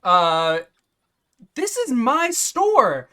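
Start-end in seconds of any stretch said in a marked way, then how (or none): 1.5-3.1 high, loud, affronted complaint; angry indignation. Acted. Shopkeeper complaining after a theft, angry indignation. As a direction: protest loudly as the wronged owner. Shopkeeper quarrels over the ibex.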